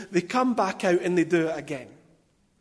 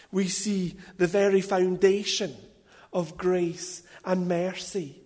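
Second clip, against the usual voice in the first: ordinary delivery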